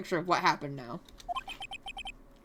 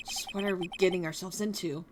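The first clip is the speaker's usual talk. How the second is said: usual speaking voice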